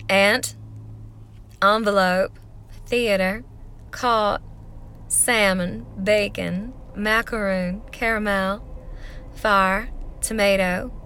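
played-up Southern accent word list. Shown as a demonstration. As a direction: drawl each word in a thick Southern accent. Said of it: "So I'll do 'em in a Southern accent".

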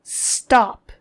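loud, sudden barked stop; sharp command. Acted. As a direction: cut them off hard.

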